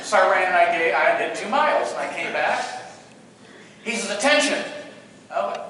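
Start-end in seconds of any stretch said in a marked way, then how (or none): 4.2-4.6 raised, higher call to attention; drill command. Retold or imitated. Drill instructor to returning runner, raised command. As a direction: call it out as an order.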